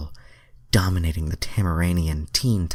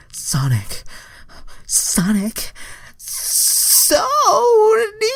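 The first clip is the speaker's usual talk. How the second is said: rising, louder cries of his name; screaming Starfire; 6 dB louder, much slower than usual. A narrator's reading. Narrator voicing the heroine crying out, high and loud. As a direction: climb higher on each cry. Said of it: "Starfire screamed".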